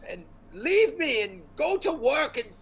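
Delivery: very high, stammering, frantic rant; flustered scolding wizard. Acted. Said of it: "he looks, he's like".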